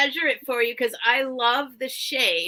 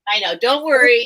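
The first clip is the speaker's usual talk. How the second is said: ordinary talk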